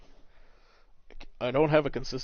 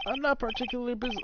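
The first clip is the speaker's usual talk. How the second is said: higher, quick character read; light, hurried higher voice; wrong character's voice; 13 semitones higher than usual. Acted. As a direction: read it high and brisk. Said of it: "Oh, wait, no, that's him".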